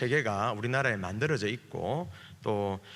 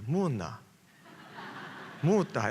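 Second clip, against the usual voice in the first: two curt Gyeongsang words, done; 무뚝뚝한 경상도 사투리; terse Gyeongsang dialect; 3 semitones higher, slower than usual. Retold or imitated. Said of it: "원래 오리지널 경상도 사람들은".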